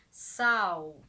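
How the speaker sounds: sal dropped low and loud; low falling sal. Shown as a demonstration. Teacher demonstrating the voice going down on sal. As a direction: drop the voice down on sal. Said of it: "o som vai descer".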